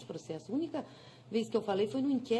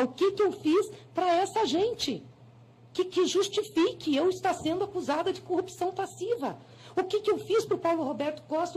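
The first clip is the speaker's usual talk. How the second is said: indignant protest; 5 semitones higher than usual. Real, not acted. Senator defending herself to the court, indignant and raised. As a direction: demand to know what crime she committed.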